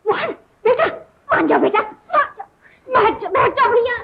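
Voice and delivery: high-pitched, anxious begging; urgent pleading. Acted. Family begging an angry young man to relent, pleading. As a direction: plead to calm him down.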